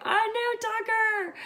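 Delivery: high, loud, drawn-out retold exclamation; triumphant cry. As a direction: stretch it out high, excited at guessing right. Retold triumph at being right.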